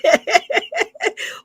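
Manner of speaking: high-pitched cracking-up laugh; real laughter. Real, not acted. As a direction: burst out laughing helplessly. Cracks up at the clip.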